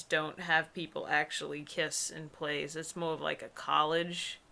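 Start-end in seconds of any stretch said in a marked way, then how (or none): none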